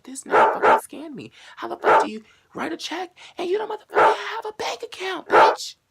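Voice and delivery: very high, loud, near crying; shrieking outrage. Retold or imitated. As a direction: wail at being scammed. Realizing she was scammed.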